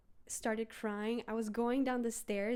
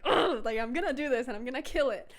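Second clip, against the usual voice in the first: louder, fired-up resolve; louder determined groan then vow; 3 semitones higher, 7 dB louder than usual. Retold or imitated. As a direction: psych yourself up, louder. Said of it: "I was like".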